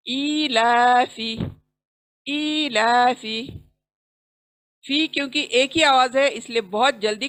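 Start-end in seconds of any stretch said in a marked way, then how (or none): 0.0-1.3 slow, drawn-out ईलाफी with the long vowel held; slow tajweed reading of ईलाफी; Quran recitation drill. Shown as a demonstration. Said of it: "जहाँ पर खींचना है उन्हीं को खींचेंगे". 2.3-3.4 slow, drawn-out ईलाफी with the long vowel held; slow tajweed reading of ईलाफी; Quran recitation drill. Shown as a demonstration. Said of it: "जहाँ पर खींचना है उन्हीं को खींचेंगे".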